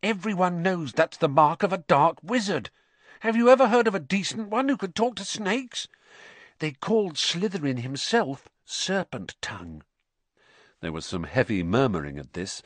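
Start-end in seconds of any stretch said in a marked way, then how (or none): 0.0-9.8 higher-pitched schoolboy spreading suspicion; lightly voiced accusing Ernie. A narrator's reading. Ernie to fellow Hufflepuffs about Potter, lightly voiced accusation. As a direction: make the case against a suspected dark wizard.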